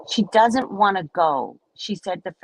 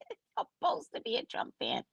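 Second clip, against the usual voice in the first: normal talking voice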